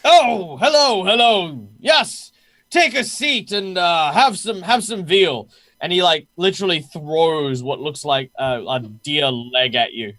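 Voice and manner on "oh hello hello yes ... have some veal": high, loud, hearty welcome; jovial guard captain. Acted. Jovial host welcomes newcomers to his feast, hearty and loud. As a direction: boom a delighted greeting in a higher voice.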